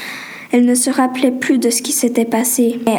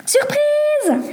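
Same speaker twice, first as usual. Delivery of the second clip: high, sustained singsong cry; cried-out surprise. A narrator's reading. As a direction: call it out high and drawn out. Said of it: "cria l'homme".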